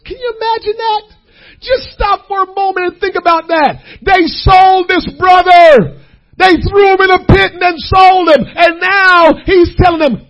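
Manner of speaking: high, loud, near crying; tearful full-force preaching. Acted. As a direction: cry out the wonder of it.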